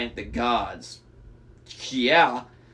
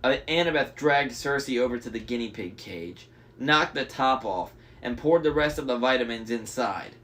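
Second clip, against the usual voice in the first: usual speaking voice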